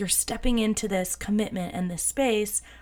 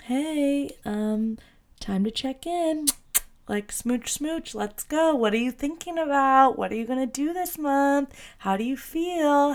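high, playful angel voice; little Cupid angel; 5 semitones higher, slower than usual. Pretend. Cupid whispering on your shoulder, high and playful. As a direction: coax like a cheeky cherub on your shoulder. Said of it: "Cupid as your little angel on your shoulder being like".

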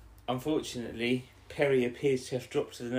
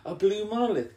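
high, loud awestruck exclamation; hammed-up wonder at a strange sight; mock amazement; 11 semitones higher, 6 dB louder than usual. Retelling character's awe at the Tardis.

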